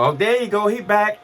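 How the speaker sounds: happy shout of relief as he wakes up; excited doctors. Retold or imitated. Doctors cheering their patient out of a coma, high and loud.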